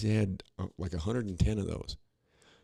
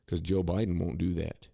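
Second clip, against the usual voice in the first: usual speaking voice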